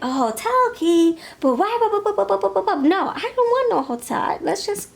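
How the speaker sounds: high, loud, stammering objection; flustered protest. Retold or imitated. Listener balking at the hotel key idea, high flustered stammer. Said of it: "And you're like".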